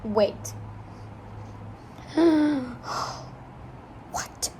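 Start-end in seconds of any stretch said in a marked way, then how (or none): 2.0-3.3 sudden gasp; sharp shocked gasp. Real, not acted. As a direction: gasp at the page. Shocked by the chapter.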